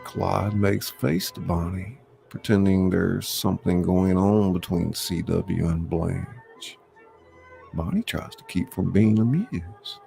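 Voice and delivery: ordinary delivery, nothing marked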